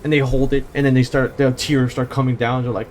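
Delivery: normal talking voice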